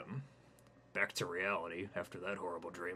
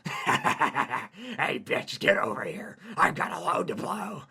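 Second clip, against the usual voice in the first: demonic spider; 8 semitones higher, 10 dB louder, slower than usual. Acted. Demonic spider taunts Hayden.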